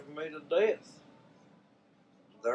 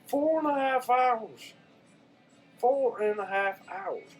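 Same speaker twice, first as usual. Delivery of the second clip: higher, disbelieving repeat of the phrase; stunned, repeat it in disbelief; incredulous; 9 semitones higher than usual. Real, not acted. Outraged at hold time.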